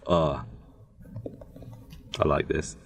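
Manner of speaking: ordinary talk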